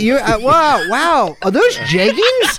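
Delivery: hyped-up stranger chat. Pretend. Overfriendly rider admires a passenger's clothes, mock-amazed. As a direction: marvel at a stranger, dragging the last question. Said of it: "really hyped up for talking to random people".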